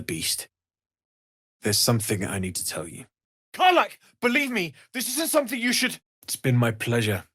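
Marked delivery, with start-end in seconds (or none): 3.5-6.0 high-pitched, pressing appeal; insistent protest in a raised pitch; urgent plea. Acted. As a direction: plead urgently, voice pushed right up. Urgent plea to Karlach, cut off.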